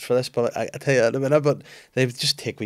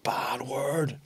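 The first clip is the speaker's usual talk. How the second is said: a hushed unvoiced whisper; 3 semitones higher, 5 dB quieter than usual. Child whispering a telling-off to mum. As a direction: breathe it like a scandalised child. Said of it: "she's like".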